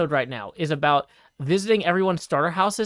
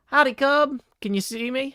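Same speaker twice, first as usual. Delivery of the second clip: calling down a hallway; 8 semitones higher than usual. Real, not acted. Calling out to distant friend.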